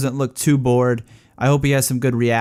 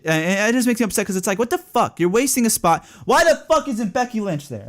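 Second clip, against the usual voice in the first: exasperated rant; 8 semitones higher than usual. Real, not acted. Ranting about a wasted title spot.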